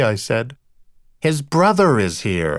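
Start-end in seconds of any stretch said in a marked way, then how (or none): 1.2-2.6 slow, mildly belligerent announcement. A narrator's reading. Astor announces the brother to Rita, mildly belligerent. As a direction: announce it with a touch of challenge. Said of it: "Astor said belligerently".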